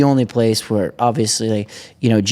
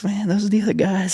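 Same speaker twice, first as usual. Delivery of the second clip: much higher, mock dismayed voice; comic realization; 11 semitones higher than usual. Rider passed in the sand, comic dismay. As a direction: play the dawning shock.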